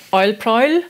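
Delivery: Oil prowl with both words rhyming on "oy"; owl prowl as "oil proil". Shown as a demonstration. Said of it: "which is spelt".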